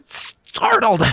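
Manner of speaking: high squeaky protest; squeaked. A narrator's reading. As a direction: squeak it out, embarrassed. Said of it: "I squeaked out".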